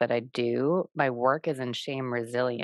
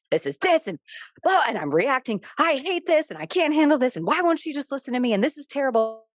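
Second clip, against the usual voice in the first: much higher and louder, hammed-up overwhelmed complaint; mock upset rant; 10 semitones higher, 4 dB louder than usual. Woman reenacting her upset reaction to her mother, hammed-up rant. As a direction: spiral into a mock meltdown about mom. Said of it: "I'll be like".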